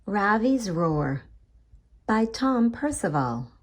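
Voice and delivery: ordinary delivery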